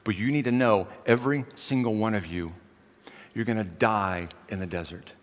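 acted stern judgment, held low; God. God passes judgment on the people, grave and low. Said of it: "that's judgment then".

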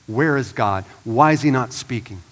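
usual speaking voice

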